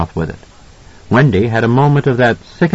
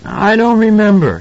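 put-on high, upset child's voice; small boy pleading, pitched way up; distraught; 12 semitones higher than usual. A narrator's reading. Tense son in mother's arms, distraught. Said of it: "he said, distraught".

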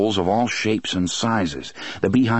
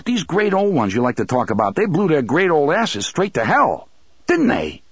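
accusing; 8 semitones higher than usual. A narrator's reading. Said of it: "almost accused".

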